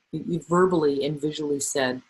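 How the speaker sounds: normal talking voice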